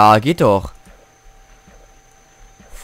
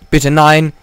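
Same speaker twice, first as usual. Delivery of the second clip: pleading cry; 4 semitones higher, 7 dB louder than usual. Real, not acted. Pleading at game danger.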